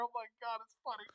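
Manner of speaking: ordinary talk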